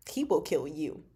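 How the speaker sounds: normal talking voice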